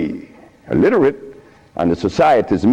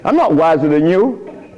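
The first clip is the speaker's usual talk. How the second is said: ordinary talk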